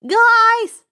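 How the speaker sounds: high, loud warning shout; warning yell. A narrator's reading. Grover yelling a warning to his friends. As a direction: yell to warn them. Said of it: "Grover yelled in warning".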